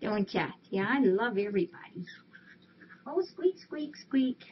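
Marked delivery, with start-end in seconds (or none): 3.1-4.4 squeaky cat talk. Real, not acted.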